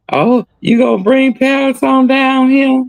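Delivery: put-on high, slow old granny; granny's voice. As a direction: drawl it like a doting grandmother. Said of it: "That's my granny".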